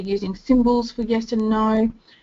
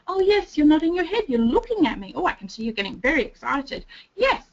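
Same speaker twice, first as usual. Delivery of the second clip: bright, high burst of praise that settles down; excited praise; 6 semitones higher than usual. Acted. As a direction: cheer the child on as they nod. Praising child's nod.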